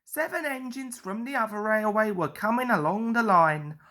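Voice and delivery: usual speaking voice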